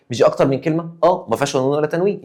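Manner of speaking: ordinary talk, nothing marked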